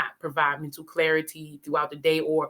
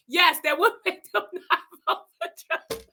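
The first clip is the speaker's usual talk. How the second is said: high voice through giggles; words bubbling with laughter; laughing while talking; 11 semitones higher than usual. Real, not acted. Speaker still tickled by coworker's idea, laughing. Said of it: "So I'm tickled".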